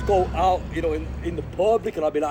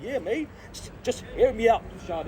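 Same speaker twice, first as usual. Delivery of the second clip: higher, lightly put-on reply; imitating himself; 6 semitones higher than usual. [name] answering his homie in a lightly higher voice. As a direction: quote your own casual answer. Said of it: "I'm like".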